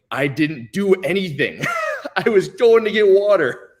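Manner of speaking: slow, high, exasperated retold plea; laughing protest. Explaining himself to students in the lunchroom, laughing protest. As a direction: protest innocence loudly through laughter.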